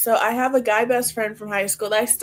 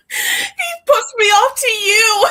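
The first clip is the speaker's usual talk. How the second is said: high, loud laughing exclamation; 14 semitones higher, 8 dB louder, slower than usual. Real, not acted. Telling her friend he passes her off, laughing loudly. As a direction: burst out laughing through the words.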